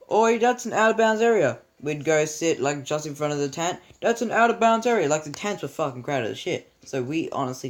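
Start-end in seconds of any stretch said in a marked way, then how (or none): 0.1-1.6 higher, louder bossy call-out; scolding teacher. Retold or imitated. Teacher chasing kids out of the tree, bossy call. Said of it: "they'd be like". 4.0-5.1 higher, louder bossy call-out; scolding teacher. Retold or imitated. Teacher chasing kids out of the tree, bossy call.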